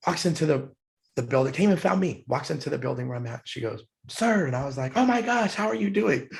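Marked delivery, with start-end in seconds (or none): 4.1-4.5 happy greeting. Retold or imitated. Soldier greets him happily. 5.0-6.3 higher, bright burst of delighted surprise; joyful surprise. Retold or imitated. Joyful reunion with former sailor.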